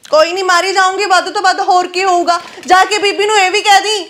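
high-pitched, heated outburst; angry defiance. Acted. Girl calling after her sister-in-law to tell mother, angry defiance.

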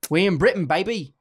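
normal talking voice

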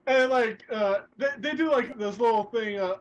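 ordinary delivery

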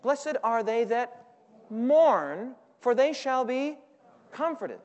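each word stretched slowly, inviting the congregation; slow, drawn-out scripture reading; slow drawn-out recitation. Shown as a demonstration. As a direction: recite it slowly so they can join in. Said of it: "what does your Bible say".

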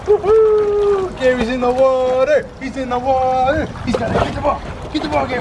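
high-pitched whooping cheer; excited cheering. Real, not acted. Owner cheering a dog into the water, high and excited. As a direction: cheer on the swimmer with delight.

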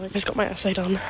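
ordinary delivery

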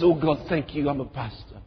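soft, low grateful aside. Retold or imitated. Pastor quietly thanking God.

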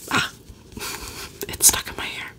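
ordinary delivery

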